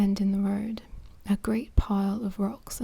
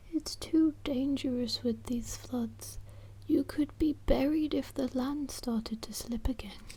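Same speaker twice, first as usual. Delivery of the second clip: whispered Mrs Thompson; 3 semitones higher, 5 dB quieter than usual. Acted. Mrs Thompson warns Pat of floods, lightly higher worried whisper. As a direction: lightly lift the pitch for anxious Mrs Thompson.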